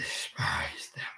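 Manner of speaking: hushed bitter whisper. Real, not acted. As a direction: whisper it with contempt.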